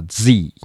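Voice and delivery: Z as "zee", voiced z and long i; American Z. Shown as a demonstration. Teacher explaining common US usage, American "zee" for Z. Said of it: "アメリカではZ".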